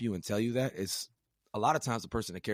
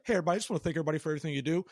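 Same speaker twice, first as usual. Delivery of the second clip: rattled off very fast, a bit higher; generic blanket thanks; 8 semitones higher, much faster than usual. Retold or imitated. Officer mocking a rushed, empty thank-you to the whole unit. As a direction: rush it like an empty formality. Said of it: "Not just like".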